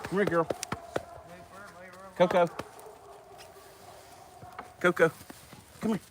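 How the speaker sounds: high-pitched repeated call; calling a dog. Real, not acted. Owner wants his dog in at night, calling out.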